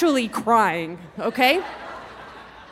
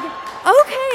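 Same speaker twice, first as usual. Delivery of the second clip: the okay leaps up high and loud; excited yes; 5 dB louder than usual. Retold or imitated. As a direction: blurt an eager okay. Son asks for another trip.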